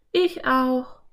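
put-on high-pitched girl character; Anne. A narrator's reading. Anne agrees with exhausted Philipp, high character voice. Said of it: "meinte sie".

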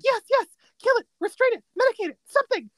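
high, loud frantic orders; hammed-up panicked urging; mock frantic. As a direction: blurt orders like an alarmed cartoon character. Acting out Batty's frantic demands.

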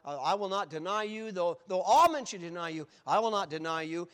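loud, forceful pledge; vowing. Acted. Preacher voicing Peter's promise to Jesus, a loud emphatic vow. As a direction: insist on it with full conviction. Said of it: "and, and vowed".

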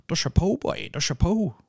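high-pitched mock country lad; culchie farmer talk. Host mocking young lads with souped-up cars, high culchie farmer talk. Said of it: "fucking culty farmer talk".

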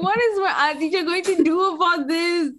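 ordinary talk

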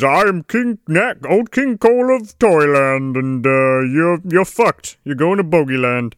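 put-on character voice of a cartoon king; mock royal proclamation in a put-on voice; Old King Cole. King threatens the dunked prisoners, mock royal voice. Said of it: "And he talks like this".

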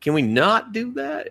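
high, uneasy no; awkward refusal. Retold or imitated. Viewer recoils from the creepy brother, high and uneasy. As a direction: squirm out of it politely. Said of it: "you're like".

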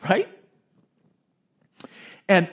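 usual speaking voice

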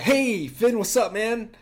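high, loud, cheery salesman voice; mock salesman calling back Finn, upbeat and loud. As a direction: ham up an eager sales rep greeting. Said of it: "Tony from State Farm's like".

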